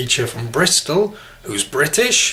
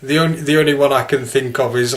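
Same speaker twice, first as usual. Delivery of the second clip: normal talking voice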